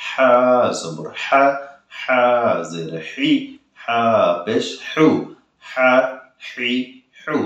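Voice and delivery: drawn-out হা জবর হা from a tight throat; pressed throat h. Shown as a demonstration. Teacher drilling after the explanation, throat-pressed h. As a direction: hold throat pressure through হা, হি, হু. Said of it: "গলা দরকার একটু চাপ দিয়ে ধরে রাখবেন".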